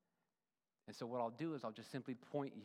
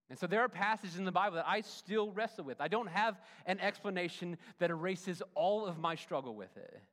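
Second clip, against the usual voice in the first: louder and higher, driving each point home; rising preacher's fervour; 5 semitones higher, 7 dB louder than usual. Acted. Pastor pressing the congregation on God's ways, fervent. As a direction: preach it with mounting force. Preacher building to full force.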